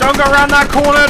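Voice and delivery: shouted warning, urgent. Real, not acted. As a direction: yell it as a warning. Warning teammate in firefight.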